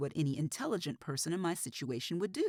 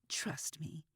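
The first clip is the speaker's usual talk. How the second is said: menacing whisper; 6 dB quieter than usual. Acted. Killer confides menacingly.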